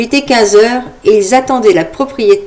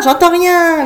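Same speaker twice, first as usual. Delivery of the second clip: high, loud hyped-up showman calling to a crowd; loud, high crowd-pumping host; rowdy party host; 8 semitones higher, 4 dB louder, slower than usual. Acted. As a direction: whip up the crowd like a fairground host. Imagined loud party host shouting.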